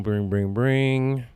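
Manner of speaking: rising mock telephone ring; imitated phone ring. Imitates hotel phone ringing.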